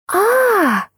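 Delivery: short high-pitched "ah" squeal; high excited squeal. A narrator's reading. Friend thrilled by the secret romance, squealing.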